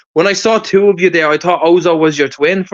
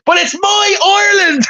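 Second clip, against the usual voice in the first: very high, exclaimed film catchphrase; hammed-up movie shout; 11 semitones higher, faster than usual. Man quoting a movie to his friends, high mock-defiant cry.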